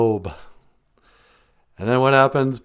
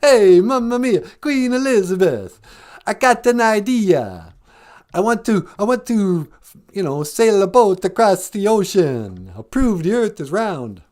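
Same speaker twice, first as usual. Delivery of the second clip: mock Italian accent; 7 semitones higher, 3 dB louder than usual. Comic impression of Columbus pitching the Queen in a mock Italian accent. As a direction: ham up a fake Italian accent. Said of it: "Is that how he talked?".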